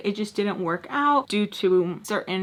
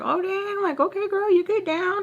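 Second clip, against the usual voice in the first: much higher, mock-impressed voice; put-on polite praise; 11 semitones higher than usual. Reacting to her Instagram nail page, put-on high praise. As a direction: feign being impressed in a high voice.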